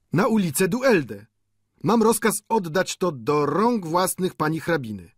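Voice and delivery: high-pitched servant reporting orders; servant's voice. A narrator's reading. Servant explaining his errand, high-pitched voice.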